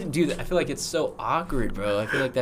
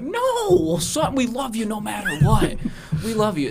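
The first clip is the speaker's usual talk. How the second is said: much higher falsetto mother; mom voice; 11 semitones higher than usual. Acted. Mother tells her son they love him, high falsetto. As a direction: reassure the son, high-pitched. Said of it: "she's like".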